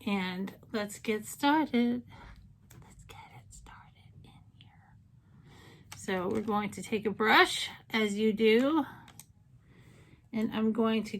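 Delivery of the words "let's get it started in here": very soft, slow whisper; whispered aside. Pretend. Vlogger quietly echoes herself while getting started, whispered. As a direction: whisper it slowly under your breath.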